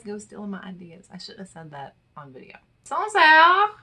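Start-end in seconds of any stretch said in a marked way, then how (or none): 2.8-3.7 high, loud call with a rise at the end; sing-song call of a daughter's name. Pretend. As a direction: call it out like calling a child home. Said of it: "I'm gonna name my daughter that".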